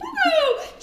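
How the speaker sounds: high loud excited call, Pogo; calling the dog. Retold or imitated. Trainer greeting jumping dog Pogo, loud high call.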